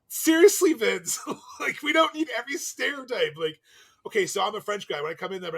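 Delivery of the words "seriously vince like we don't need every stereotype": exasperated retort. Retold or imitated. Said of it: "I'm like".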